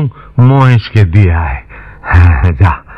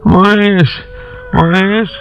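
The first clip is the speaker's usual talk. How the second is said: high, aching lament; sorrowful apology; 6 semitones higher than usual. Acted. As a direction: call out tenderly in a high, grieving voice. Weeping apology to his hungry animal.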